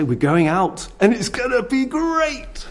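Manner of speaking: put-on high, slow, over-excited promise to a child; hyping up kids. Shown as a demonstration. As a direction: sell a trip to the shops like a party. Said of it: "you've gotta whip it up".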